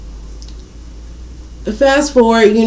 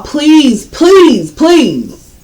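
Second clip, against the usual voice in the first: pleading; 6 semitones higher, 4 dB louder, much slower than usual. Real, not acted. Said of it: "pleading viewers".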